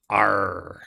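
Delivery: loud pirate "arr"; pirate growl. Pretend. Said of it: "loosely related to Talk Like a Pirate Day".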